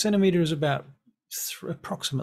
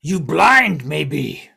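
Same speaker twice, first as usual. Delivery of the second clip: scornful goblin; 2 semitones higher, 11 dB louder, much slower than usual. Acted. Goblin mocks elf who thought fort was camp, loud scorn. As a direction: jeer at them in a big goblin voice.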